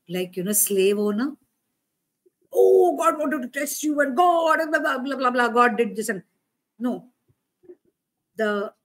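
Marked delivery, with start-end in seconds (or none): 2.5-6.1 high, loud mocking preacher voice; mock preaching. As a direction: mimic overblown church preaching, dismissively. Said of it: "The way the church preaches it".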